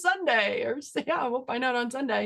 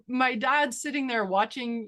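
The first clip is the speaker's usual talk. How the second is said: ordinary talk, nothing marked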